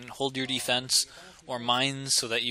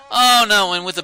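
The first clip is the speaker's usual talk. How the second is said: dismayed outburst; 8 semitones higher, 14 dB louder than usual. Real, not acted. Caster seeing the defence go wrong, loudly dismayed. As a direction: cry out as the play goes wrong.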